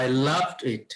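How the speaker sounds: "loved" given the weight of the phrase; stress on loved. Shown as a demonstration. Teacher models a reply that shows enjoyment, stress on "loved". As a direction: lean on "loved", not on "I" or "it". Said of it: "আমরা যদি stress না দেই".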